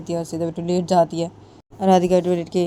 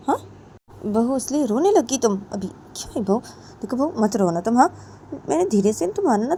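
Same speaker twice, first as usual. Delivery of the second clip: put-on higher, pleading older woman's voice; coaxing mother-in-law; 5 semitones higher than usual. Mother-in-law coaxes the crying daughter-in-law, higher, pleading. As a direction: plead with her to stop crying.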